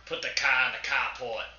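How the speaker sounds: slow, put-on Boston accent; exaggerated Boston accent; mock Boston accent. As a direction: drop the r's like a Bostonian. Joins in mocking Boston accent.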